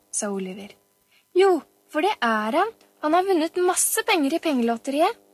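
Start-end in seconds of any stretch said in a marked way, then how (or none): 1.4-5.2 put-on higher, louder little-girl voice; insistent boasting child. A narrator's reading. Pia-Pia contradicts Oliver about the lottery win, insistent boasting child. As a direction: contradict your brother with childish pride.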